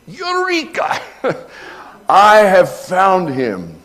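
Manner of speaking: high, loud cry of discovery, then slow; joyful cry. Acted. The prophet's last rejoicing cry, jubilant. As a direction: shout Eureka in rejoicing. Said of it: "uttered in its last rejoicing".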